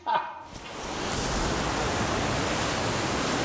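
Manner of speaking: soft airy laugh after the ride; breathy relieved laugh. Real, not acted. Relieved after leaving the cave.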